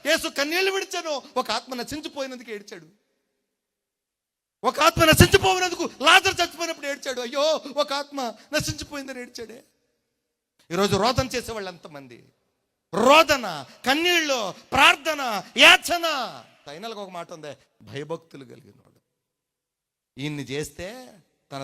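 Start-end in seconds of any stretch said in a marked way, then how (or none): 4.6-16.0 high, loud impassioned sermon cry; fiery preaching. Acted. Pastor urging the church to weep before God, loud impassioned cry. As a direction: cry it out to the crowd.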